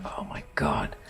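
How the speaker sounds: low whisper, worn out by pain; exhausted whisper. Retold or imitated. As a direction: breathe it out, spent. Lying in severe pain.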